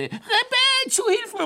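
high screech that drowns in blubber; screeching, then bubbling. A narrator's reading. Hare breaking through the ice, screeching as it sinks. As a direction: screech for help and gurgle out at the end. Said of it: "und es kreischte".

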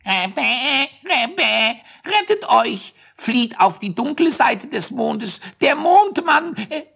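very high squawking hare; honking voice. Acted. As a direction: quack it out high and frantic. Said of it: "ganz ähnlich quäkte".

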